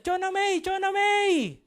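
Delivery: high, loud, drawn-out calling; frantic shouting. Acted. Mother running after her missing child, shouting frantically. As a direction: shout the name out high and long. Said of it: "sumisigaw siya".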